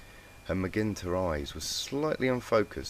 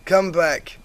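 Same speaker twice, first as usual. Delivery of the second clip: high, loud, slow plea; pleading; 13 semitones higher, 11 dB louder, much slower than usual. A narrator's reading. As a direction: beg him to stay. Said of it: "she pleaded".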